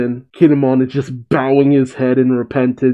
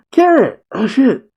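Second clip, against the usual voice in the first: gasp, then a much higher "Carrot!"; thrilled surprise at a favourite showing up; a real delighted yelp; excited gasp; 11 semitones higher than usual. Real, not acted.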